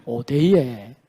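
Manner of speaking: low, drawn-out dialect denial; regional Korean dialect. Shown as a demonstration. Preacher recalls the dialect word for strong denial, low dialect retort. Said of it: "사투리 하나 가르치셨는데".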